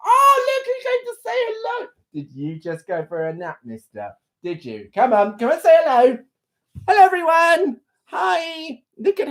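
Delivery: high cooing voice to the pet. Real, not acted. Host greets her sleepy pet on camera, high cooing.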